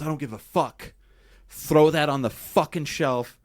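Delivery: bang the words down like he's done arguing; defiant mock outburst. [name] defying the label.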